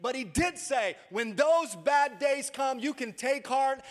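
loud, high, driving preacher's voice; preaching at full force. Acted. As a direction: rouse the congregation with rising fervor.

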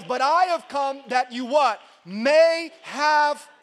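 loud, high call to the church; preacher calling out; preaching call. Acted. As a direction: pull the crowd into the answer. Call and response climax.

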